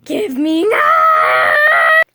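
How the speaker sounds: whiny shrieked demand, high and loud; shout. Acted. Child yelling at mom to hand it over, loud and whiny. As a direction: grab it with a wailing yell.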